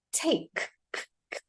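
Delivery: "take" ending on K, then K alone, softly; isolated K sound. Shown as a demonstration. Teacher isolates the k sound for learners, K ending. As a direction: release the K of "take", then repeat it bare. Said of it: "you pronounce the k sound".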